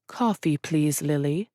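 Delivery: lightly voiced Arabic-accented father; a hint of an Arabic accent. A narrator's reading. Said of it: "his native Arabic accent".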